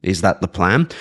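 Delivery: normal talking voice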